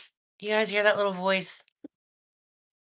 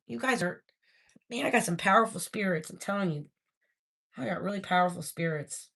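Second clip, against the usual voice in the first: ordinary talk, nothing marked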